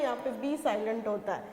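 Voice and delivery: ordinary talk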